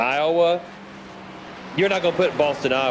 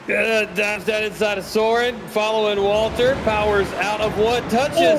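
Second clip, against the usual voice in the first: higher, quick race call through the pass; live play-by-play; 6 semitones higher than usual. Acted. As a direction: call the move as it happens.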